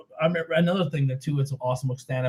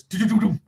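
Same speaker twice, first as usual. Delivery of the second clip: mock phone beeping, louder; imitated noise; 5 semitones higher, 5 dB louder than usual. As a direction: make the line sound like beeps.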